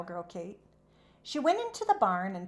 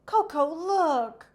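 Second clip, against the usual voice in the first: calling out, high and louder; raised, slow eager call; excited call; 8 semitones higher, 8 dB louder, slower than usual. A narrator's reading. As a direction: call the horse over, thrilled. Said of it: "cried Cowgirl Kate".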